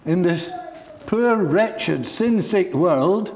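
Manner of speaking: a high, ringing, fervent sermon voice; impassioned preaching. Acted. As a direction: preach it out over the room, rising to a cry. Preaching at full force.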